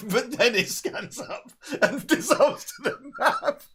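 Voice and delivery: high-pitched, slowed by laughter; laughing while talking. Real, not acted. Describing the cut to the map to a co-host, laughing. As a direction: struggle to get the words out through laughs. Cracking up at own film joke.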